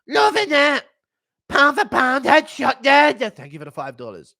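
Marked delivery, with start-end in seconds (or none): 0.1-3.3 high, loud hyped commentator; excited Joe Rogan impression, much higher and louder. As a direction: gush over the fighter. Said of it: "Impression of Leon".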